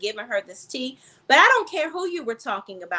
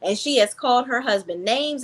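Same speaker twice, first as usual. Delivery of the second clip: ordinary talk, nothing marked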